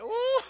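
loud, high-pitched "oooh"; whooping, impressed "ooh". Retold or imitated. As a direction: hoot an ooh at a bold retort. Reacting to the mother's retort.

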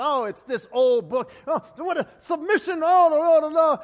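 high, loud ranting protest; mock rant. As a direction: rant like she's outraged by the old book. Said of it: "she rant and raved".

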